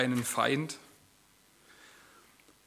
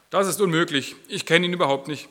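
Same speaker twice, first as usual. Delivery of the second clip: higher, louder protest; Peter protesting; 5 semitones higher, 9 dB louder than usual. Acted. Peter denying Jesus to the servant girl, louder and higher. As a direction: push the denial away hard. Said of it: "Doch Petrus widersprach".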